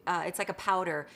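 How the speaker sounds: ordinary delivery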